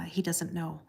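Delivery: softly retold aside; hushed, discreet imitation; confidential hush. Retelling the hushed aside once more.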